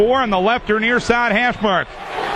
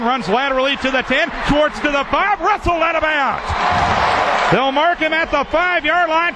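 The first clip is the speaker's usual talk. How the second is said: excited play-by-play; 6 semitones higher than usual. Acted. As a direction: build the call as he breaks free. Live play-by-play run building to shout.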